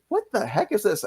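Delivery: puzzled, pitching up in disbelief; baffled reaction. Retold or imitated. Viewer recalling a weird game commercial, baffled.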